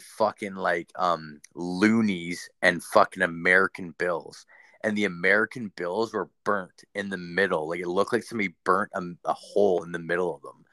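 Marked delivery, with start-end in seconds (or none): none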